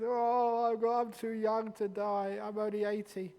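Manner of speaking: mock someone clinging to life at eighty; whiny old man. Mocking a fearful old person.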